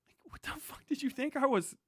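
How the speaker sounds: ordinary talk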